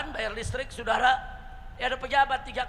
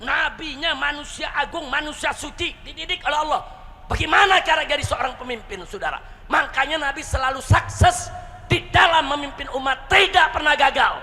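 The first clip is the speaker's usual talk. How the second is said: higher, louder sermon delivery; fervent preaching; 5 semitones higher, 6 dB louder than usual. Real, not acted. Preacher praising the Prophet to the congregation, fervent. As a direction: preach it out, building in force to the end.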